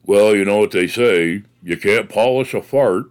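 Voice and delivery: low-pitched Southern man's voice; deep, tough Southern good old boy; deep Southern accent. Retold or imitated. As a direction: imitate a rough Southern boss, voice dropped deep. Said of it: "he had this deep voice".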